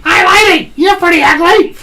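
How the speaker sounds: cockatoo voice. Retold or imitated. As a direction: do the cheeky parrot from the pet shop. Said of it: "there's that cockatoo again".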